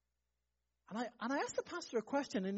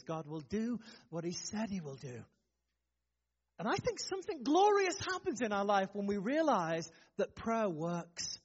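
ordinary talk, nothing marked

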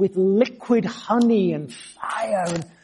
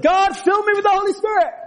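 high, sustained re-enacted call to God; retold shout; 11 semitones higher than usual. Teenager walking round a field pleading with God, shouting. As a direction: cry out high to the heavens in one go. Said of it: "I shouted again a little louder".

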